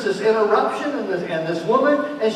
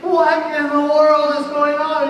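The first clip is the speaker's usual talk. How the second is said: exasperated; 8 semitones higher, 5 dB louder than usual. Retold or imitated. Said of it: "you're just sitting there thinking".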